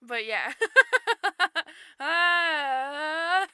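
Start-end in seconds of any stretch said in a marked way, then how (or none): 0.6-3.5 squealing laugh. Real, not acted. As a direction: burst into high laughter. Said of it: "It's like the best lisp".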